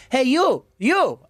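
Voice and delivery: high, punchy woman calling out; mock summons, pitch leaping up on YOU; Puerto Rican woman. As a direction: imitate her hailing someone across the room. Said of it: "There was a Puerto Rican woman".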